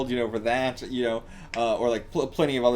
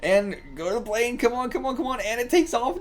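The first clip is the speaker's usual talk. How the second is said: high, rising come-on; pitched-up excited urging; 9 semitones higher than usual. Real, not acted. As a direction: cheer the plane on urgently. Urging plane on while watching.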